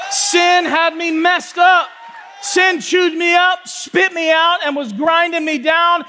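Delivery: preaching at full force. Acted. Pastor preaching to the church about sin, forceful. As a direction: drive it home like a preacher.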